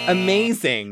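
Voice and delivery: ordinary delivery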